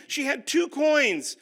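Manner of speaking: normal talking voice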